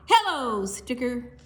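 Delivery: high, loud hello; playful high greeting. Acted. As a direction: Penguin greeting the sticker. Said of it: "Penguin greets a sticker".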